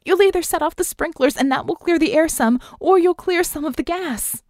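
high, loud urgent explanation; high-pitched frantic shout; yelled. A narrator's reading. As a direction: keep yelling through the whole instruction. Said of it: "she yelled".

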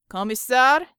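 loud call from the front. A narrator's reading. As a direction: call for the commander's attention. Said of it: "Georgie was calling from the front".

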